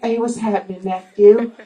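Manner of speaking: a hint of [name]. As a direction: ease into it like after a blunt. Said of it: "he's gonna show like".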